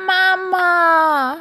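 high, loud despairing kitten call; desperate cry. A narrator's reading. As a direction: wail for a missing mother. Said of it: "schreit Karlchen verzweifelt". (verzweifelter, hoher Ruf)

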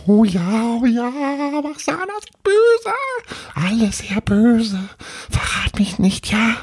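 very high, wailing tiny ghost, pleading at the end; fearful little ghost. Acted. Little ghost begs Paul not to betray it, high frightened voice. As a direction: wail in dread, then beg.

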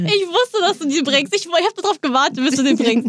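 high, giggling through the words; laughing. Real, not acted. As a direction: squeal it while laughing. Co-host laughing at silly voice.

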